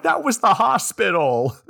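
a citizen realizing the hospital is gone; high slow mock dismay. Townsperson watching the hospital fall, high slow dismay.

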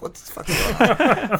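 high, laughing what the fuck; stunned laugh breaking into the question; shocked disbelief. Retold or imitated. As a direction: caught off guard, half laughing in disbelief. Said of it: "I'm like".

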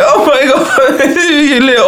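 gushing laugh over a pet; laughing coo. Retold or imitated. Player gushes at the reunited pet pig, laughing. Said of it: "I was like".

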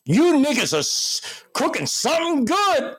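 high, savoring hum then compliment; Chappelle's milkman character. Retold or imitated. Milkman smells the family's dinner, high and savoring. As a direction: sniff the food and praise it in a high voice. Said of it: "And he goes".

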